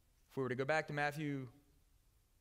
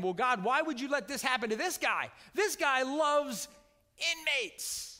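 raised, high-pitched cry of disbelief; anguished protest to God; 10 semitones higher, 6 dB louder than usual. Acted. Congregation questioning God over the beaten director, anguished loud protest. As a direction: cry out why, drawing out the last words. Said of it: "even find ourselves saying".

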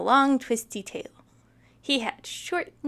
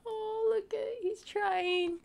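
tender aside; 8 semitones higher, 6 dB quieter than usual. Real, not acted. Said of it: "sympathetic aside at the picture".

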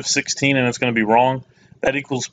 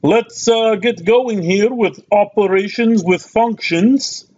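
higher-pitched mock accent of no clear country; put-on foreign accent; 6 semitones higher, 3 dB louder than usual. Maths teacher welcoming viewers to a precalculus video, put-on accent. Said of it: "I don't know why I'm doing that accent".

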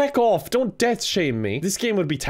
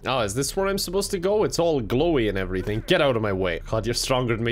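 ordinary talk, nothing marked

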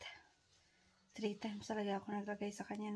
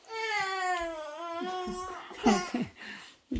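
high, loud laugh breaking into a sob; laugh-cry; 9 semitones higher, 6 dB louder than usual. Real, not acted. Laughing in despair. (mataas at malakas na tawang may iyak)

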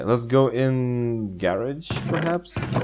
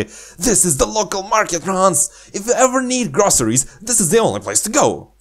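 high, fast, perky guide voice; high enthusiastic girl; 9 semitones higher than usual. Pretend. Redoing the market line as an eager girl. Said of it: "She seems very enthusiastic".

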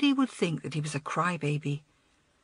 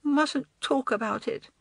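put-on higher-pitched reluctant little boy; small boy's reluctant reply; muttering small boy; 6 semitones higher than usual. A narrator's reading. Tearful boy holds back from older girl, muttering. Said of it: "he muttered".

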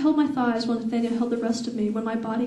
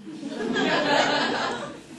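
laughing; 4 semitones higher than usual. Real, not acted. Reader laughs mid-story.

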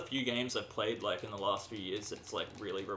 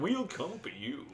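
high, drawn-out threat; hammed-up menacing voice; mock villain; 8 semitones higher than usual. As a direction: slowly taunt as a game baddie. Playing menacing pursuer in game.

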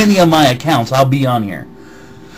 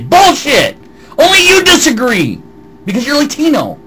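angry outburst; 10 semitones higher than usual. Real, not acted. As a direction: fire it back at him. Angry retort to commenter.

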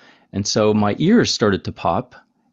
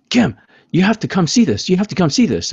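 high, louder eager call; excited urging; 9 semitones higher, 4 dB louder than usual. Retold or imitated. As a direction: pitch it up like a friend who can't hold a secret. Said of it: "saw me and said".

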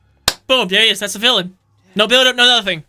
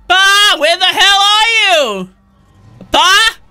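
high, loud repeated calls for papa; calling for papa; 7 semitones higher, 4 dB louder than usual. Pretend. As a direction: holler like a lost kid searching. Playing kid calling for father.

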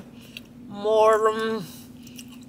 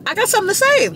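excited; 10 semitones higher, faster than usual. Real, not acted. Eager to share gossip.

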